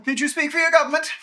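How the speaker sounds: loud, fast, imperious demand; booming arrogant alien overlord. Acted. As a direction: press the question haughtily. GM voices dragon repeating question.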